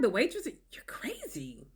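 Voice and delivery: slow, hushed whispered scorn; mock whisper. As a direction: whisper it as if she's mad. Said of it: "gaslighting and denial".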